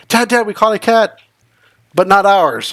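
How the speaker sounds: put-on high, excited child calling Dad; excited kid. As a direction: blurt it out like a thrilled kid. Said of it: "Lauren came to tell me is like".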